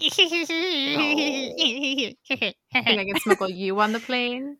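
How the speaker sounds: slow, cackling witch voice; witch cackle. Pretend. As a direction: croon it like a scheming old hag. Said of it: "I'm just like, witch cackle".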